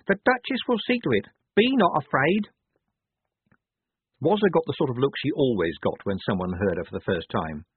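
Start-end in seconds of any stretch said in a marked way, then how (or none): 0.1-2.5 put-on high, assured young believer; confident. A narrator's reading. Wazza promises the Duchess will see to it, confident. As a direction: declare it with complete faith. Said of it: "said Wazza confidently".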